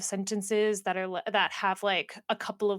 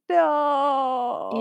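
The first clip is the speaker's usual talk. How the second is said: anguished no; 6 semitones higher, 6 dB louder than usual. Retold or imitated. Reliving an emotional reading moment.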